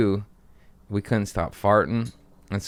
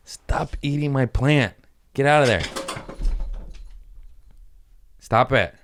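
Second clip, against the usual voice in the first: scolding the cat; 5 semitones higher than usual. Real, not acted. Owner yelling at cat in plant, scolding. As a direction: snap at the cat to stop.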